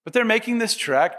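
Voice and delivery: normal talking voice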